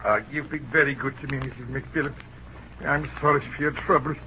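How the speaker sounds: hushed, apologetic sympathy; subdued condolence. Acted. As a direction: offer sympathy gently. Calmer condolence to bereaved mother.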